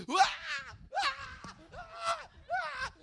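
high frantic mock yelps; short wild yelps, acting out a scramble; frantic yelping. Preacher reliving the money booth, frantic high yelps.

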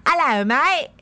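put-on lightly done Australian accent, mate rising; a quick Aussie hello, half-hearted; mock Australian accent. Host greets Jays from Australia in a light Australian accent. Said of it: "I promised I would do an accent".

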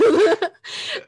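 loud high laugh bursting out; big burst of laughter. Real, not acted. Laughing at her mum's reply, loud burst.

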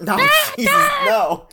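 piercing high bat screech; bat speak. Acted. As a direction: shriek it like a squeaking bat. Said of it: "that's bat speak".

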